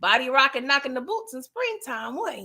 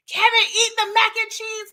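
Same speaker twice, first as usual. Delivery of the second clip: higher, louder pleading command; urging Kevin; 7 semitones higher, 4 dB louder than usual. Pretend. Pleading with Kevin on screen to eat, higher and louder.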